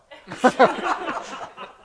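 loud, high burst of laughter; real breathy laugh; amused laugh. Real, not acted. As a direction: crack up at your own joke. Amused at his own story.

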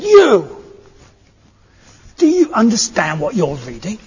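a shrill high yell of You! from an ugly old hag, then her lightly voiced challenge; half-acted old hag pointing a stick at the monk. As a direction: jab the word You! high and loud like a pointing crone. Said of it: "ugly old woman, this old hag".

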